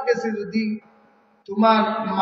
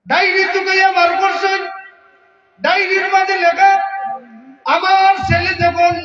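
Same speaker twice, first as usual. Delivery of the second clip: fiery preaching; 4 semitones higher than usual. Acted. Preacher voicing the father's hurt at his son at full force. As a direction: voice the old father's diary as a fiery sermon.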